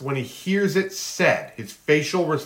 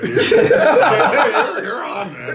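laughing; 8 semitones higher, 6 dB louder, much slower than usual. Real, not acted. Laughing praise of friend's monologue.

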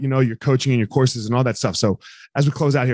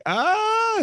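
surprised realization. Retold or imitated. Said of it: "they were like".

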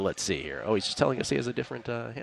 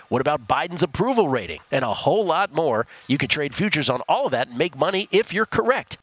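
ad read; 5 semitones higher than usual. Acted. Reads a sponsor ad.

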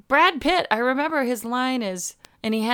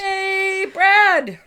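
high excited cheer; 10 semitones higher, 7 dB louder than usual. Real, not acted. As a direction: call out his name with glee. Cheering for Brad Pitt.

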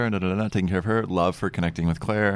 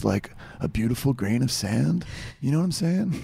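high laid-back surfer dude; surfer guy voice; 5 semitones higher than usual. Pretend. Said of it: "Surfer guy".

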